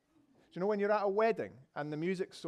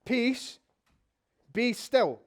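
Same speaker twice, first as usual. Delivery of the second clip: put-on firm, raised command to naughty kids; higher, louder rebuke, a hint of stern schoolmaster; stern telling-off; 6 semitones higher, 6 dB louder, much slower than usual. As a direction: scold the storm like a teacher. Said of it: "gives the wind and the sea a telling".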